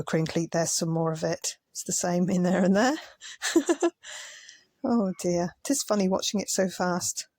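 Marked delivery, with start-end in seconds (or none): 3.0-4.3 breathy laugh. Real, not acted. Laughing at sped-up footage.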